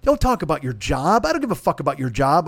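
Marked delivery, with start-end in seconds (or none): none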